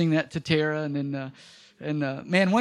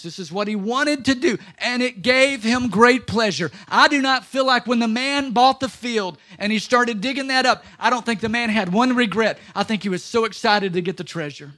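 rising, louder preaching; building sermon; 5 semitones higher, 6 dB louder than usual. Acted. Preacher stirring the congregation about the treasure, rising louder. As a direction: lift it like a revival preacher.

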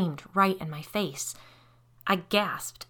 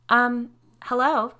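awkward, drawn-out hello, pitched up; hesitant greeting to a stranger, slow and higher; 6 semitones higher, 7 dB louder, slower than usual. A narrator's reading. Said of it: "I said awkwardly".

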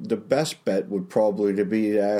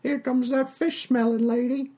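higher chatty tavern barmaid. Acted. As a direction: play the friendly, simple barmaid. GM voices Tallulah the barmaid.

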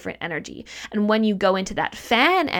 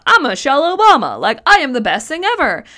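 higher, louder mock boast; mock self-important celebrity; 6 semitones higher, 9 dB louder than usual. Imagining Michelle Obama bragging, mock vain boast. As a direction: puff up like a vain star, playfully. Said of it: "doesn't walk around every day and going".

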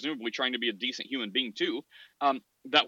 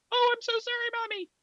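put-on high, squeaky child voice, much higher; sorry little kid. Wide-eyed child says sorry to mommy, high squeaky kid voice.

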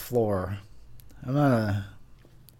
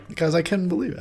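ordinary delivery

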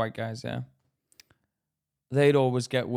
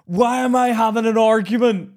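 loud, raised exasperated outburst; retold exasperation; 7 semitones higher, 8 dB louder than usual. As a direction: cry out at the absurdity, retold. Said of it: "I used to find myself thinking".

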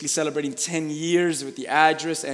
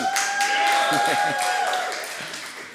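laughter. Real, not acted. Laughs after joke.

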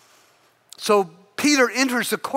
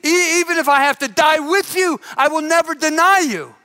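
higher-pitched earnest promise; insistent vow; 6 semitones higher than usual. Acted. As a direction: swear it with mock fervor. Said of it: "Peter insisted".

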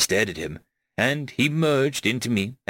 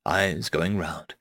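usual speaking voice

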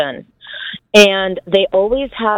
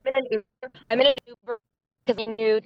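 choppy, dropping-out phone audio; faint, glitchy connection from an Uber; breaking-up call audio; 3 semitones higher, 9 dB quieter, faster than usual. Real, not acted. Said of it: "you're breaking up".

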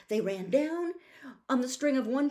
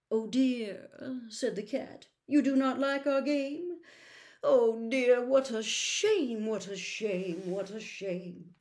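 mock sad cat; 2 semitones higher, 5 dB louder than usual. Cat mock sadness, fading.

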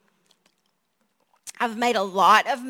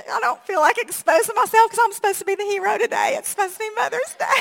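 higher, fast, teasing voice; playful self-mockery; 8 semitones higher, 4 dB louder, faster than usual. Real, not acted. Mother joking to her congregation, higher and fast. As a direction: tease herself as the would-be hero.

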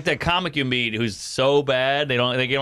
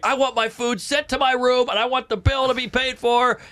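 high, demanding mock tirade; mock yelling; 9 semitones higher than usual. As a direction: imitate an irate dad ordering a waitress around. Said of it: "he's yelling at some poor girl".

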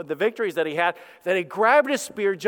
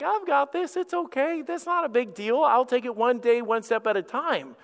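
breezy self-reassurance; 5 semitones higher than usual. Acted. Us talking ourselves through a little trouble, breezily reassuring. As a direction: start high-pitched, brushing trouble off. Voicing forced confidence in small troubles.

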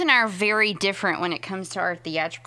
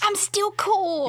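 silly high voice; 13 semitones higher, much slower than usual. Retold or imitated. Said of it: "And I'm like".

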